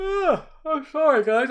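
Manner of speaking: talking through a yawn. Real, not acted. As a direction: stretch it out mid-yawn. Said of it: "I'm yawning tonight".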